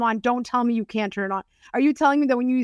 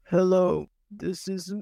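lowest tone; 2 semitones lower, slower than usual. Shown as a demonstration. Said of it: "the lowest tone of your voice".

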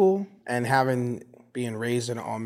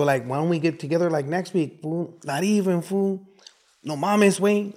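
higher, louder Chicano-accented banter; Mexican-American neighborhood accent; 6 semitones higher, 5 dB louder than usual. Shown as a demonstration. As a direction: talk like back home in a Mexican neighborhood, loud and singsong. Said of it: "This was my accent before I started traveling".

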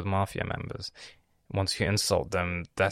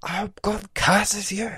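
much higher, slow mocking sister voice; mock curse; 13 semitones higher, 3 dB louder, slower than usual. Imitating his sister cursing him in Arabic, shrill mockery. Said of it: "she said".

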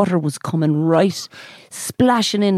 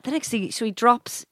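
ordinary delivery

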